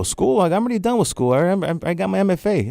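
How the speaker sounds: high, disbelieving "School?"; incredulous. Retold or imitated. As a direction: scoff at the idea. Incredulous reply to mentor.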